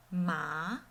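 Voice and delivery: MA rising from low; second tone. Shown as a demonstration. Teacher practices the second tone, rising. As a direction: lift "ma" like a question. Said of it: "Second one".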